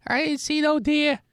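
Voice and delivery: homeless man voice. Acted.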